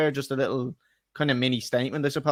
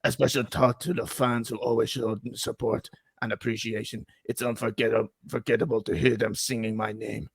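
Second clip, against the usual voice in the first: mock Italian accent, mostly lower-pitched; Antonio Conte accent; 3 semitones lower than usual. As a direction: sign off like an Italian coach saying farewell. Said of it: "can you just do the accent?".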